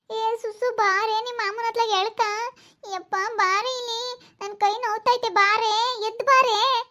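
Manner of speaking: high, pleading calls for help; pained woman calling out. Acted. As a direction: call out high for someone to come.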